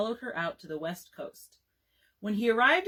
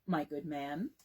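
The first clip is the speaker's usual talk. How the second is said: lower, softer, mildly condescending; patronizing; 5 semitones lower, 6 dB quieter, slower than usual. A narrator's reading. Dad talks down to the bricklayer, mildly patronizing. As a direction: say it down to him with a patronizing smile. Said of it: "with his most patronizing smile".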